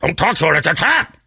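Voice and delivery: hammed-up admiral sounding the alarm; Admiral Akbar. Inner Akbar warns him off a coworker, high mock alarm. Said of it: "Admiral Akbar ... shouting that".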